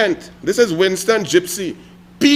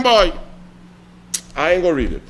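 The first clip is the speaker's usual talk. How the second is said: ordinary delivery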